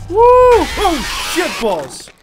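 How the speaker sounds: high shriek of fright; shrieking scare. Real, not acted. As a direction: scream at the jump scare. Killed by animatronic.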